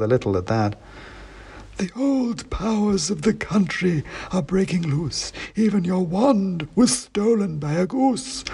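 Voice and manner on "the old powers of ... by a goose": high, slow, dusty oracle voice; dusty faint ancient head. A narrator's reading. Ancient king's head answering the wizard, slow high dusty chant. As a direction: intone it like a faint, dusty myth.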